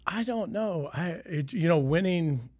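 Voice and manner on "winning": normal talking voice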